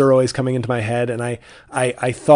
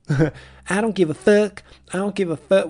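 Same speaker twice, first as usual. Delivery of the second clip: mimicking Ozark character; 7 semitones higher than usual.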